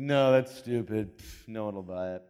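mild mock scorn; dismissive younger self. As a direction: wave the iPhone away with a scoff. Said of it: "I was like".

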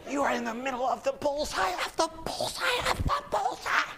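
high, straining voice, building on each repeat; hammed-up climactic praise. Telling the patient he hit the bullseye, over-the-top high climax. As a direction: push the repeats up into a high mock frenzy.

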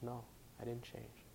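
very soft, low retold denial; murmured refusal, hardly voiced; softly. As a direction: quote him in a hush. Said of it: "he says softly".

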